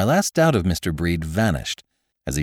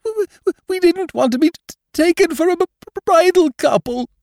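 high, stammering sobs; young bride sobbing on a friend's shoulder, much higher and louder; 7 dB louder than usual. A narrator's reading. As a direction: choke the words out through tears. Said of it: "sobbed Missus Breed".

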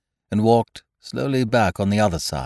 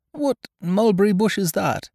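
puzzled Pooh; 11 semitones higher than usual. A narrator's reading. Pooh asks Eeyore what he means, high and puzzled. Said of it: "and then asked".

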